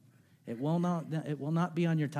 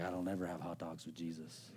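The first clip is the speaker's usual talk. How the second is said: sheepish quiet reply; 6 semitones lower, 10 dB quieter than usual. Retold or imitated. Pastor retelling his reply to a mentor, meek and quiet. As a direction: drop to a soft, small mumble.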